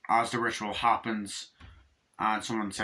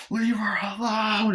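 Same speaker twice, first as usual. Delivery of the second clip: much higher, louder and slow, yelled; protective shout; 11 semitones higher, 4 dB louder than usual. Retold or imitated. Son yelling at father again, slow loud shout. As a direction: drag out the warning as a yell.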